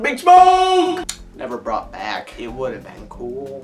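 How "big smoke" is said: excited. Real, not acted. Excited reaction to transformation.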